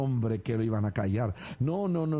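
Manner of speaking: normal talking voice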